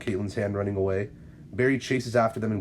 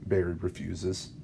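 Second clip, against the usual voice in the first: ordinary delivery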